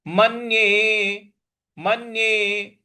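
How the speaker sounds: मन्ये slow, with a doubled n; stress on n. Shown as a demonstration. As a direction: lean on the n of मन्ये, then hold the e. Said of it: "न है उस पर हमको आघात देना है". (मन्ये में न पर आघात)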